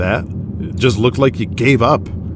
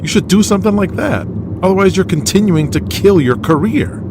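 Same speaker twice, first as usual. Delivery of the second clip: urgent push; 10 semitones higher than usual. Acted. Young wrestler pushing veteran hard.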